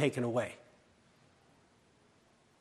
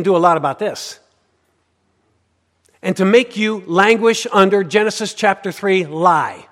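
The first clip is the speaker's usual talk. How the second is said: loud, driving preacher's voice; full-force preaching; 9 dB louder than usual. Acted. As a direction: preach it hard to the congregation.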